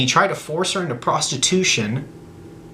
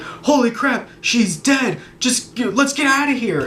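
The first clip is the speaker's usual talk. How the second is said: high-pitched mock panic; panicked bystanders; 8 semitones higher than usual. Bystanders finding the crashed car, mock panic. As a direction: act out an alarmed onlooker, pitch jumping up.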